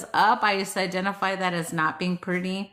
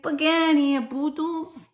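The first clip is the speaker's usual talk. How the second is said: high-pitched New Jersey brush-off; New Jersey accent; 6 semitones higher than usual. Acted. Repeating the card's line for fun, in a New Jersey accent. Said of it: "Sounds like a New York accent".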